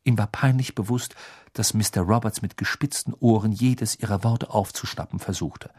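plain reading voice

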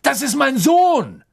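high, loud cry of protest; desperate yell, stretching the words; desperate shout. A narrator's reading. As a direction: shout to protect your son. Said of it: "schrie eine Stimme".

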